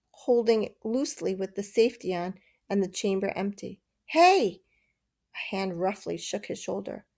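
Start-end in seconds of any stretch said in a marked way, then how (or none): 4.0-4.6 a sharp shout, much higher and louder; loud call. A narrator's reading. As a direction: jolt him awake. Waking a sleeping boy.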